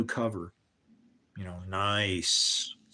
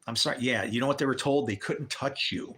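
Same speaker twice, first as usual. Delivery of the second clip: normal talking voice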